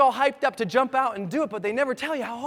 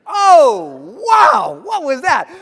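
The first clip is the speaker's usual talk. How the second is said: high, loud acted shock; startled shouting; 6 semitones higher, 11 dB louder, much slower than usual. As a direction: yell it out, startled mid-fall. Startled mid-fall, retold.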